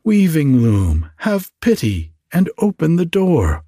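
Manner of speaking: high, beseeching fairy cry; pleading call. A narrator's reading. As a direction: beg for pity, pitch climbing high. Fairies begging the loom for help.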